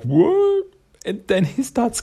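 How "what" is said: sudden very high, loud "What?"; shocked creature. Retold or imitated. Raza shocked when Raph takes the bandana back, a high yelp. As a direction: yelp it out in disbelief. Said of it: "Raza's like".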